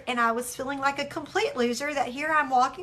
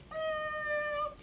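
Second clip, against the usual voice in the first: high, soft drawn-out hum; soft high thinking noise; hesitant hum; 9 dB quieter than usual. Retold or imitated. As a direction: hum a long doubtful "mm", pitched up. Acting out adviser's hesitant hum.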